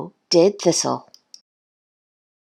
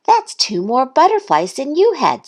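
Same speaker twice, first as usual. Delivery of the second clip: high, impressed duck; Duck's voice; 8 semitones higher than usual. A narrator's reading. Duck comparing Thistle with Goose, high duck voice. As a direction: point it out in a high voice. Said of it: "said Duck".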